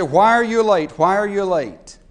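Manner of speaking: scolding boss. Retold or imitated. Boss scolding late secretary.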